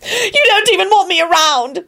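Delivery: high-pitched tearful bawl; acted weepy outburst; Elizabeth, bawling. A narrator's reading. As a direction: wail it like someone in a tantrum. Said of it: "she bawled as she stomped".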